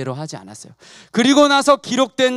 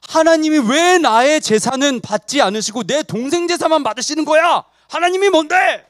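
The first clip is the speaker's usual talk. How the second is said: indignant protest rising to a higher pitch; acted resentment; 4 semitones higher than usual. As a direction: build into Cain's angry challenge. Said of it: "이렇게 생각하면서 분내는 거". (분내며 따지는 연기)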